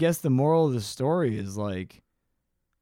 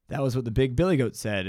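normal talking voice

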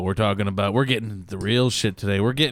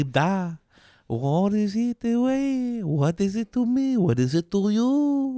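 mock French accent; 9 semitones higher than usual. Joking to his cohost in a silly French accent. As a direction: play a cartoon Frenchman musing. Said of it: "That's why I did that accent".

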